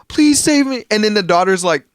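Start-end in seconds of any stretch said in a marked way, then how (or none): 0.1-0.8 put-on very high pleading child voice; high-pitched mock plea; imitated little girl. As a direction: mimic a child begging for rescue. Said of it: "this little girl".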